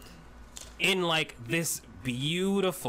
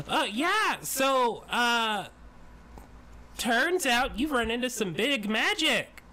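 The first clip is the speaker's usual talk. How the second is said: excited chatter; 10 semitones higher than usual. Acted. Excited tiny woman explains.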